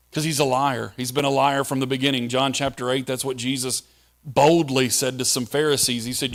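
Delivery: ordinary talk, nothing marked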